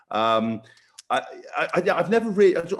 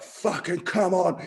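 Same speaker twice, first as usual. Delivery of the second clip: slow, higher mock rallying cry; drawn-out retold battle cry; old-school coach; 5 semitones higher, slower than usual. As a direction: lightly imitate a coach geeing up the huddle. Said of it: "they're kind of so old school".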